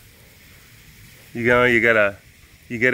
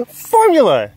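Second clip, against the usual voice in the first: Formula! shouted much higher and louder; shouted exclamation; 11 dB louder than usual. Real, not acted. To the camera about the animals, a gleeful shout. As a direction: yell the word Formula! with glee. Echoing the word excitedly.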